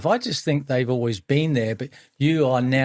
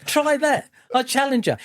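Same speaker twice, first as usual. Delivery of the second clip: higher, teasing challenge; playful dare; 12 semitones higher than usual. Real, not acted. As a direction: goad him into it. Daring friend to ice challenge.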